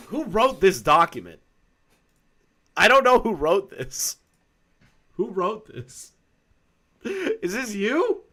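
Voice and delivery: high, teasing disbelief; mock incredulous. Real, not acted. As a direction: pretend to be appalled. Teasing about document author.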